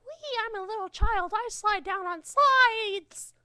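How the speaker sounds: put-on very high squeaky child voice; little child voice. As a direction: squeal it like a giddy little kid. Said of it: "not like a".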